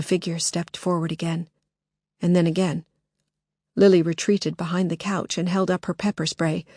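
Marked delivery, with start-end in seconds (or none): none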